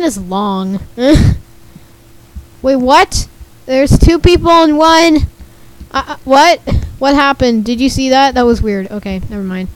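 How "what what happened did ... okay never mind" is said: normal talking voice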